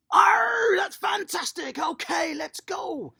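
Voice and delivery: loud, high, cheering "Arr"; excited pirate shout. Pretend. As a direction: whoop it like a delighted pirate. Coach cheering pirate game.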